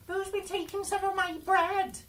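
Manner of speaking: a tiny dwarf, outraged and shrill-pitched; squeaky dwarf. Acted. Third dwarf asks.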